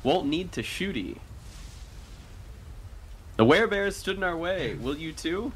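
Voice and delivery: hammed-up pirate threat with rising lilt; John impression. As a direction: menace them like a playful sea captain. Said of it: "do his best John impression".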